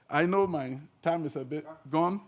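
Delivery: ordinary delivery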